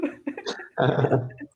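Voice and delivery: loud laugh at own joke; burst of laughter. Real, not acted. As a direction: let out a big laugh.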